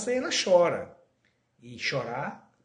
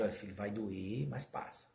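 softer, lower, reassuring; a soft parent's honest warning; gentle honest parent; 6 semitones lower, 9 dB quieter than usual. Retold or imitated. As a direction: calmly tell the child the truth. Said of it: "trabalhar a verdade".

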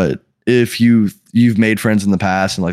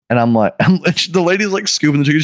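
normal talking voice

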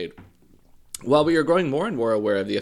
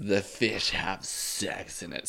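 mocking middle schooler; 4 semitones lower, slower than usual. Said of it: "something that people would say".

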